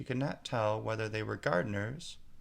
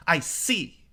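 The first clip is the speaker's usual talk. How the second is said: much higher, louder, ominous; cold queen; 8 semitones higher, 9 dB louder than usual. A narrator's reading. Queen judges the gardeners. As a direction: she has decided.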